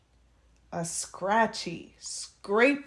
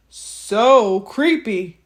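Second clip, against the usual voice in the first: spooky storyteller voice; 8 dB louder, much slower than usual. A narrator's reading. Drawn-out spooky aside.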